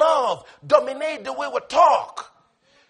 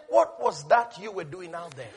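the Lord; 5 semitones lower, 4 dB quieter than usual. Retold or imitated. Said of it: "the Lord looks at you and says".

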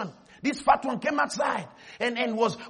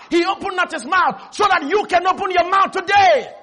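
loud and higher preaching voice; preaching at full force; 6 semitones higher, 6 dB louder than usual. Real, not acted. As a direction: drive each line home to the crowd.

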